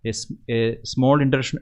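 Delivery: ordinary talk, nothing marked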